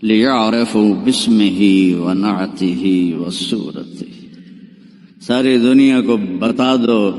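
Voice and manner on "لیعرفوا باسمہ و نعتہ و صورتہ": solemn, slow intoned Arabic; slow, solemn Arabic recitation. Acted. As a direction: intone it as a divine call. Voice from the cloud, Arabic.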